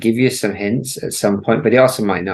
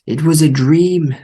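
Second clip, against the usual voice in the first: higher, louder and dreamy; 7 semitones higher, 6 dB louder than usual. Acted. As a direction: announce it like a revelation.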